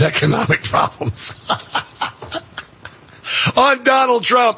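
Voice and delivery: real laugh breaking through mid-sentence. Real, not acted. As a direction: chuckle at the absurd claim. Host laughs mocking Garcia's claim.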